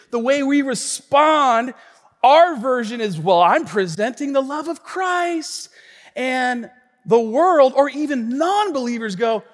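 high, loud, slow sermon delivery; forceful preaching. Real, not acted. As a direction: drive the point home to a congregation. Preaches the application.

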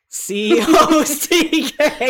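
slow, emphatic spelling; slow loud spelling. Shown as a demonstration. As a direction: spell C O C K slowly, high. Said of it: "Especially when you're dictating cock".

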